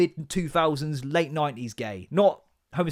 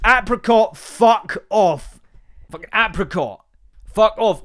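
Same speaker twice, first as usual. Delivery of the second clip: loud, higher mock outrage; exasperated disbelief; 5 semitones higher, 6 dB louder, slower than usual. Parent appalled by daughter's Americanised apricot, exasperated outburst. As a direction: spit out the offending word in comic disgust.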